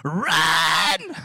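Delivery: loud urgent yell to flee; urgent shout. Retold or imitated. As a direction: scream at the kid to run. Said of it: "my cousin Matt is like".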